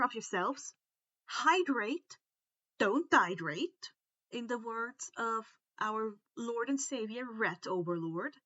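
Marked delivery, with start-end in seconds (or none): none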